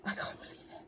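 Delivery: breathless whisper of disbelief; a pretend jaw-drop, whispered; mock stunned whisper. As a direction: play the viewer, too shocked to speak aloud. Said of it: "You're gonna be like".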